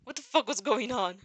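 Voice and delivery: ordinary delivery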